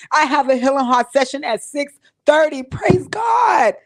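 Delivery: crying, voice rising to a high shout on praise God; sobbing thanks that breaks into a high shout; tearful praise. Real, not acted. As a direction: cry it out with grateful joy. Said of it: "I didn't mean to get emotional".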